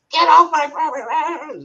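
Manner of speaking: high, loud imitation of an angry yell; sudden retold shout. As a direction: holler it out as if overheard from afar. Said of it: "all of a sudden I hear this".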